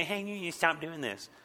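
put-on soft, childish parroting; mocking echo. Kid throwing an adult's words back, mocking. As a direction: regurgitate it in a small mocking voice. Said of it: "what you said in a mocking tone".

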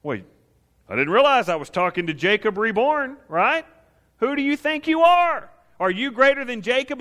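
high, mocking voice, treating him like he's nuts; mock him with exaggerated disbelief; the woman ridiculing Jesus with sarcasm. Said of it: "She's talking to him like he's nuts".

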